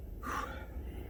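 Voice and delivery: long breathy exhale; heavy tired sigh. Real, not acted. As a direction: blow out a weary sigh. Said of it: "I'm already out of breath".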